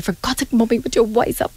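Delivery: a hint of an irritated teenage son; annoyed son. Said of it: "really annoyed".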